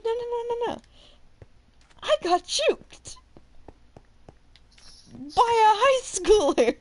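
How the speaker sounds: high-pitched string of no's, rising in dismay; mock dismay. Teacher to colleague about being juked, high mock wail. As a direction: wail at being outwitted by a teen. Outsmarted by a student.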